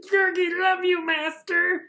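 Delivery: very high, drawn-out creature squeal; Gurgi. Retold or imitated. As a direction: announce yourself like an excited cartoon critter. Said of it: "a Gurgi thing appears again".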